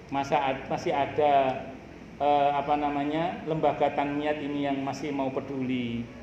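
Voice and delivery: low, measured voice of gratitude; suara hati bersyukur. Acted. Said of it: "Syukuri ngomong". (suara syukur yang rendah dan tenang)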